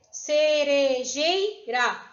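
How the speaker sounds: "Cerejeira" slowly split ce-re-jei-ra; syllable by syllable. Shown as a demonstration. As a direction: sound out "Cerejeira" in four drawn-out beats. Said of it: "Vai ter quatro sílabas".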